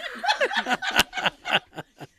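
a big real laugh, high and loud; loud laughter. Real, not acted. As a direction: burst out laughing hard. Laughs at the [name] tape.

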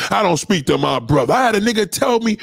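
ordinary talk

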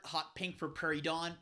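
ordinary talk, nothing marked

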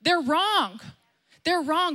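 a hint of a shouted warning; mock shout to the world. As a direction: call it out like a street preacher. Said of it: "going out and shouting".

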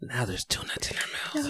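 very soft, whispery aside; hushed, whispery play-by-play; hushed commentary. Real, not acted. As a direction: drop to a near whisper. Hushed commentary on nut-crunching.

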